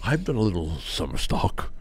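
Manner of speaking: soft, unvoiced mock boast whisper.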